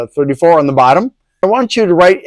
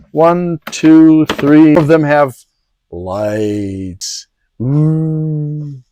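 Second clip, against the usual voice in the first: sarcastic; much slower than usual. Real, not acted. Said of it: "I'm being sarcastic there".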